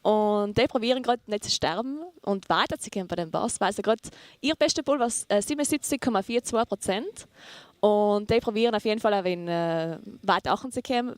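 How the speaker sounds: casual commentary in German dialect; chatting along in a regional German dialect. Shown as a demonstration. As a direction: speak in your own German dialect. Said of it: "tell us what's happening ... in your dialect".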